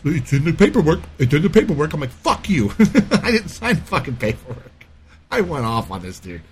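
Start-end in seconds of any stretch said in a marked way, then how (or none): 0.0-1.9 higher, louder mock manager repeating himself; mocking the rigid manager, pitched up; mimicking manager. Manager voiced in retelling. 2.1-4.7 amused telling-off of the manager; laughing retort. Retold or imitated.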